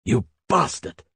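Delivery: a touch of contempt, yelled; angry shout. Acted. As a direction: spit the insult at him. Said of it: "shouted Hals".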